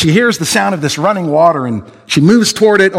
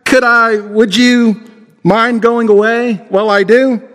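put-on higher hesitant girl's voice; a scared girl asking the lion to leave; nervous, stumbling girl's request; Jill, timid; 7 semitones higher, slower than usual. A narrator's reading. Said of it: "Jill said".

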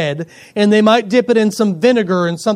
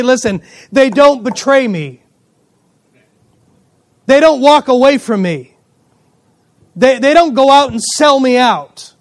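rising, louder preacher's cry; fervent preaching; 5 semitones higher, 6 dB louder than usual. Real, not acted. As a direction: drive the point home from the pulpit. Pastor preaching climax to congregation.

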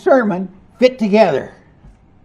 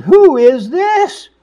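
high, slow, louder wonder; marveling; 9 semitones higher, 7 dB louder, slower than usual. Acted. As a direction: say it in amazement. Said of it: "they marveled".

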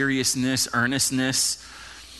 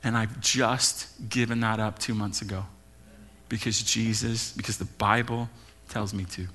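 low, soft, halting retelling of a tearful confession; holding back tears; 5 semitones lower, 5 dB quieter than usual. Retold or imitated. Pastor retelling a woman's confession after service, holding back tears. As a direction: imitate restrained tears, quietly, with long pauses. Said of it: "tears, holding back".

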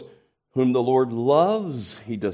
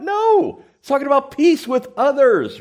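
much higher and louder, with a sharp "No"; emphatic rebuttal; 14 semitones higher, 7 dB louder than usual. Real, not acted. Preacher corrects the church, loud and high. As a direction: snap the congregation out of the daydream. Preacher rejects the mocked idea.

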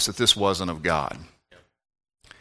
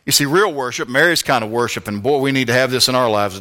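ordinary talk, nothing marked

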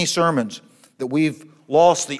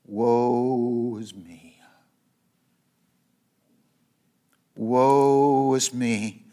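slow lament; 4 semitones lower, much slower than usual. Acted. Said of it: "this is what he said".

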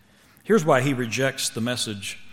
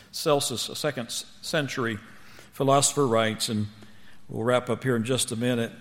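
usual speaking voice

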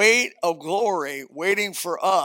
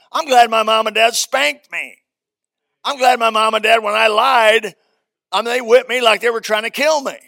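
loud, high, fervent preaching; preaching at full force; 5 semitones higher, 10 dB louder than usual. Real, not acted. Preacher testifying to the congregation, loud and high. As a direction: shout it out to the whole church.

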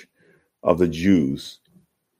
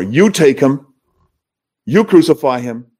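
exasperated Pilate; 6 semitones higher, 4 dB louder than usual. Acted.

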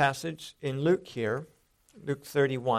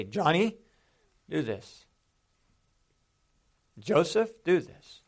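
lightly voiced parent, name raised, then the order; parent getting attention. Shown as a demonstration. Parent gets a child's attention before a command, firm. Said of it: "parents want to get the attention".

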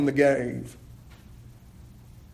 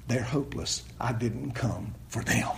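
low, soft, grave quote; quiet, low pronouncement; hushed verdict; 5 semitones lower, 9 dB quieter than usual. Acted. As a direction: drop the voice right down. Quoting Jesus in hushed aside.

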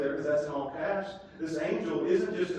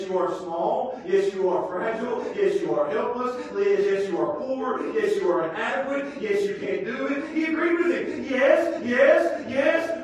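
punched, louder yes after yes; emphatic yeses; 6 semitones higher, 6 dB louder than usual. Real, not acted. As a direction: hammer each yes harder. Emphatic agreeing repetitions.

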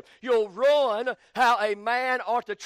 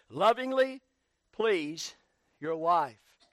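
normal talking voice

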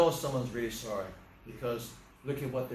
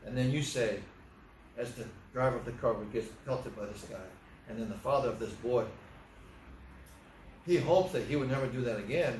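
ordinary delivery

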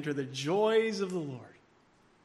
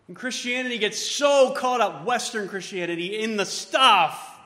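louder, higher pulpit voice building to a peak; full-force preaching; 6 semitones higher, 8 dB louder than usual. Acted. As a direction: preach it out with rising fervor.

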